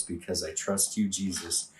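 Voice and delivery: quiet whispered-toward devotion; soft response. Shown as a demonstration. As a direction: drop to a soft, intimate response. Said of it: "I'm gonna say".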